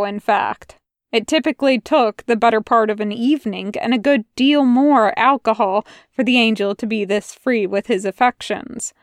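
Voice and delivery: plain reading voice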